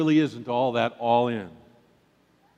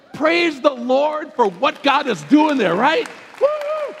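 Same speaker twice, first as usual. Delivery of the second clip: excited; 11 semitones higher, 7 dB louder, slower than usual. Real, not acted. Preacher rousing the congregation.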